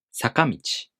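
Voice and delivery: peak on the ka of sakamichi, then fall; middle-high accent. Shown as a demonstration. Teacher giving an example of the pattern, sakamichi in middle-high accent. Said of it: "The pattern for this word is middle high".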